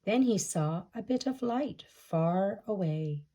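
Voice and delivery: ordinary delivery, nothing marked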